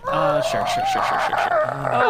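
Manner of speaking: very high, squeaky yes-yes-yes; squeaky nervous agreement. Acted. NPC nervously agreeing to fake talk.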